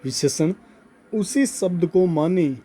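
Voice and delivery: slow, spaced-out phrases for note-taking; slow dictation. Real, not acted. Teacher dictating a note to students, slow dictation. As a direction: dictate it bit by bit, drawing words out. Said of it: "note लिखवा रहा हूँ".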